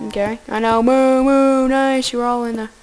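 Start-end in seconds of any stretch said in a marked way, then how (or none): 0.8-1.7 loud playful cow moo; mock moo. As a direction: moo at the cows, louder. Mooing at the cows.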